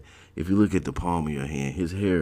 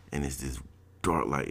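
usual speaking voice

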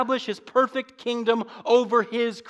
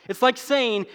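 ordinary talk

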